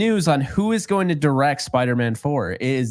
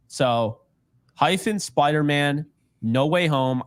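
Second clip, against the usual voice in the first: ordinary talk, nothing marked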